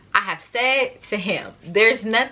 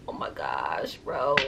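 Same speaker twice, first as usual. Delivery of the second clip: much lower, much softer, breathy gasp of dread; trapped at the elevator, hushed fright; hushed panic; 9 dB quieter, slower than usual. Retold or imitated. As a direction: barely get it out, caught and scared. Boyfriend stops the elevator.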